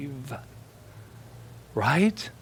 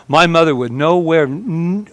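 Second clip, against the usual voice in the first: ordinary talk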